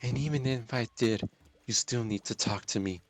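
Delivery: normal talking voice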